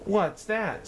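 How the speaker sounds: high, sharp royal snap; snapped question. Acted. Yertle hearing a sigh below, high sharp snap. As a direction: bite off the question. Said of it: "snapped the king".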